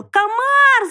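high, loud drawn-out wail; high-pitched cartoon shriek; piglet's yell. A narrator's reading. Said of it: "завопил поросёнок".

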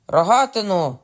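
loud, high call for the weapon; high cry falling at the end; shouted demand. A narrator's reading. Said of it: "крикнув король".

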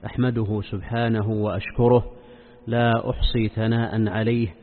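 solemn, measured sermon opening; formal standard arabic. Acted. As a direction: recite the opening praise formally. Formal opening praise formula. (افتتاحية خطبة رسمية هادئة)

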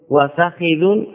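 وفخذ as wa-fa-khi-dhun; unshortened وفخذ: fa-KHI-dhun; original full form. Shown as a demonstration. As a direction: say وفخذ with a, then i on the kha. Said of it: "اللغة الأصلية".

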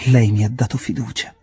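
loud, solemn declaration; solemn, emphatic statement. A narrator's reading. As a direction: declare it gravely and with force. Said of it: "disse in tono solenne".

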